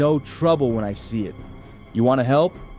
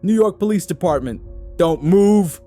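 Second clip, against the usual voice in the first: loud, higher police shout; barked command; 9 semitones higher, 5 dB louder than usual. Acted. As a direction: cop barking orders at intruders. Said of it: "he barked".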